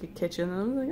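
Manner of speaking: ordinary delivery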